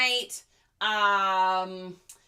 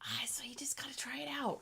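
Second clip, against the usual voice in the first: whispering; 3 semitones higher, 12 dB quieter, faster than usual. Real, not acted. Quiet aside to viewers.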